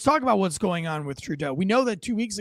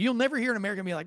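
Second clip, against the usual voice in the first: ordinary delivery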